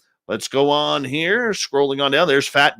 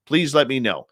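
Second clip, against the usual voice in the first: usual speaking voice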